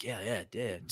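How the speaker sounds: quietly smug pretend reply; hushed mock agreement. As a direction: murmur it like a private boast. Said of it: "I also am like".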